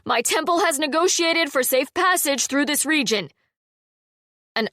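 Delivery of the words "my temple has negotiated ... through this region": calling out. A narrator's reading. Zale calling to bandits.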